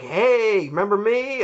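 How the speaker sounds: playfully voicing Santa greeting a stranger; mock cheery Santa greeting. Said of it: "trying to be like".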